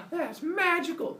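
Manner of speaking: high, drawn-out awe; wonderstruck, hammed-up squeal; mock excitement. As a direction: gasp it out in amazed delight. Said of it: "they get all excited".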